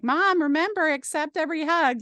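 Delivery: mock-nag like her children; a hint of the kids teasing their mom; kids reminding mom. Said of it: "they'd be like".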